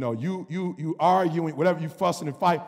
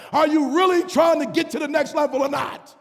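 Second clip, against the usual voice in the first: louder, higher preacher shout; full-force preaching; 7 semitones higher, 3 dB louder than usual. Acted. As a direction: call out the church on their excuses.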